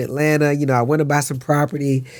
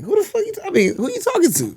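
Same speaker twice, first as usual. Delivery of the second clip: high, sharp confrontation; mock outrage; faster than usual. Actor retelling the scene's blowup, high outrage. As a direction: jump up in mock fury.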